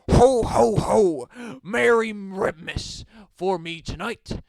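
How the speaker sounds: loud, booming ho-ho-ho; jolly Santa. A narrator's reading. Red man in a sleigh grabbing dinner, jolly Santa voice. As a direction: belt it out like Santa. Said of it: "a red fat man in the sleigh".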